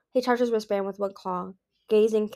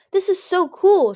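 much higher, louder, slow; thrilled; 11 semitones higher, 10 dB louder, slower than usual. A narrator's reading. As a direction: savor the thrill. Anemone thrilled with necklace.

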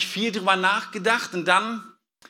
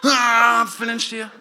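a louder groan of effort, then breaking into a laugh; louder straining branch, laughing; 3 semitones higher, 4 dB louder than usual. Pretend. Preacher repeats the straining branch, louder and laughing. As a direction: push harder, laughing at it yourself. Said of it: "Der Zweig war so".

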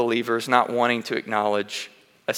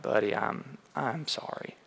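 ashamed apology; 10 dB quieter than usual. Retold or imitated. Father apologizing to crying son.

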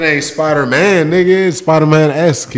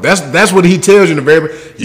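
ordinary talk, nothing marked

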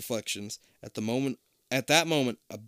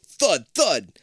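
thud thud; 4 semitones higher, 7 dB louder than usual. Acted. Sound effect of landing.

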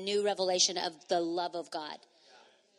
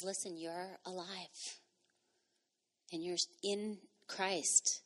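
tender hush; 8 dB quieter, slower than usual. Real, not acted. Tender reassurance to congregation.

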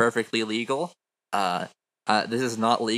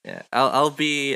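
ordinary talk, nothing marked

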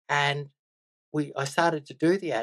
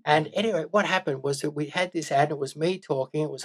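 normal talking voice

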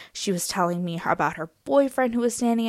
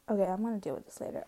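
quick whisper; 10 dB quieter, much faster than usual. Real, not acted. Brushing it off to herself.

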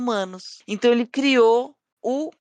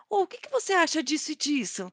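high put-on voice; 8 semitones higher, 3 dB quieter than usual. Staff voiced in retelling.

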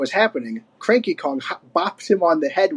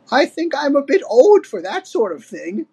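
put-on higher-pitched old lady voice; bow out apologetically, pitched up; Wrinkly, apologetic; 9 semitones higher than usual. A narrator's reading. Old wife declining the adventure, apologetic. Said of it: "said Wrinkly apologetically".

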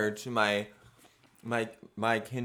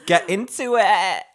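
sassy catchphrase; 9 semitones higher, 9 dB louder than usual. Acted. Catchphrase punch.

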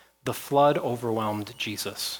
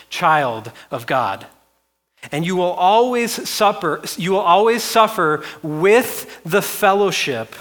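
emphatic preaching; 4 semitones higher, 6 dB louder than usual. Real, not acted. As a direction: drive the promise home to the congregation. Preaches promise at full force.

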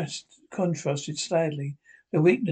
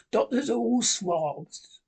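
slow, higher, weak-voiced curse spat out with gusto; feeble but hearty curse; 6 semitones higher, slower than usual. A narrator's reading. Bedridden captain curses the doctor, slow feeble hearty curse. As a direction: curse him feebly but with relish, slowly. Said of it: "cursing the doctor in a feeble voice, but heartily".

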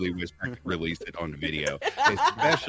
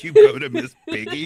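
high, loud laughing squeal; laughing disbelief; 13 dB louder than usual. Real, not acted. Incredulous laughing question.

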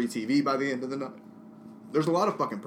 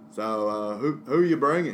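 lightly voiced old Texan coworker, a bit louder; coworker from Texas; 2 semitones higher, 7 dB louder than usual. Coworker from Texas asking who he's bringing, lightly voiced. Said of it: "He's from Texas".

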